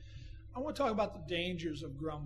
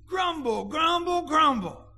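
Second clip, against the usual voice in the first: mock grumbling; 8 semitones higher, 12 dB louder than usual. Mocking the people's grumbling.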